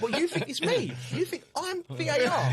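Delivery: high laughing disbelief; cracking up mid-sentence. Real, not acted. Laughing at abusive fan.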